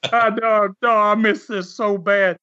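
high-pitched, mock-tearful homesick plea; tearful homesickness. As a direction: act out being homesick to tears, voice lifted. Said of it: "with tears in my eyes".

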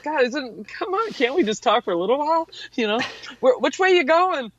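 high, pleading and a bit fast; hammed-up friendly whine; mock plea. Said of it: "I thought".

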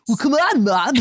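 exaggerated surfer-kid whine; whiny kid imitation. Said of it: "my voice is getting weirder every time".

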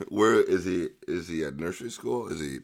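ordinary talk, nothing marked

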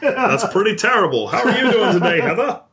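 high-pitched mock Aussie; chirpy put-on Australian; Australian accent. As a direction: put on an Australian voice, well above your own. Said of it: "Your Australian accent".

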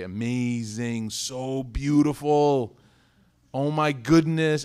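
put-on slow, drawn-out gushing praise for a child's painting. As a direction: stretch every word, hamming up the delight. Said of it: "before you have to say".

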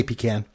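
"pecan" said pee-CAN; second syllable of "pecan" rhymes with "can"; Canadian pronunciation. Shown as a demonstration. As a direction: say "pecan" with a short a as in "cat". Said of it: "it's a Canadian way to say it".

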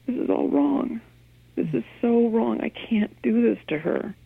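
high, pained inner thought; anguished inner voice. Retold or imitated. Mother's inner voice in the car, anguished. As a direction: let the despair crack through. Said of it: "I feel like".

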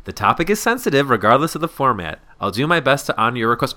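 high, theatrical email reading; mocking read-aloud. Friend reading out Amber's reply to her face, mocking. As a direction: launch loudly on the greeting, then read with relish.

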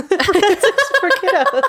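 high, slow, laughing echo of kiddos. Real, not acted. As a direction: repeat it cracking up. Amused echo.

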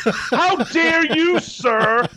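very high, slow, laughing indignation; mock outrage. Co-host teasingly scolding his partner for the reality-TV detour, in hammed-up outrage. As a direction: ham up being deeply offended.

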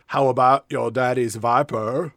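hammed-up Southern accent; Sean Gump. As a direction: imitate a Southern movie hero. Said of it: "a character that spoke like this".

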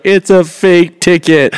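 high, slow mock wail; drawn-out put-on crying voice; crying wife. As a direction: imitate a tearful confession. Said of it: "she's crying".

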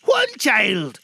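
high, loud character voice; Scrooge's voice. A narrator's reading. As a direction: answer loudly as an agitated old miser. Scrooge answers the ghost.

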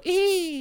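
short high mock shriek of a fleeing woman; hammed-up girly eek while running away; mock squeal. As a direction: squeak it out like you're running off, hamming it up. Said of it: "run away holding your breasts and go".

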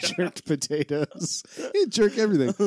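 hammy Jamaican lilt, cracking up; Irish Rastafarian accent. Pretend. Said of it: "that was my Irish Rastafarian accent".

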